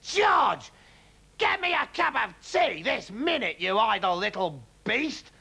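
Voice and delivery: high, loud bossy demand; grandma barking orders from the rooftop; angry yell. A narrator's reading. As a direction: snap orders at the boy. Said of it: "she yelled".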